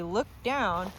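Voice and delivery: ordinary talk, nothing marked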